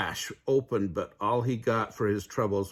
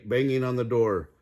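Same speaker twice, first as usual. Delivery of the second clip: ordinary delivery, nothing marked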